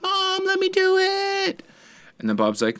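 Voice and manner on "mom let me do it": put-on very high, drawn-out kid whine; exaggerated falsetto pleading; pleading daughter. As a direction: imitate a child begging Mom, squeaky and slow. Voices daughter pleading with her mom.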